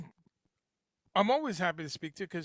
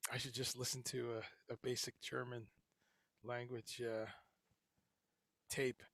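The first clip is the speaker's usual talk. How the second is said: ordinary talk, nothing marked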